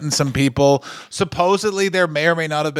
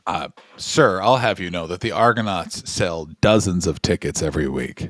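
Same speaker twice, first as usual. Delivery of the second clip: lower, pompous mock offence; mock indignant; 9 semitones lower than usual. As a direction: defend the team like an offended gentleman. Mock indignant defence of Argonauts.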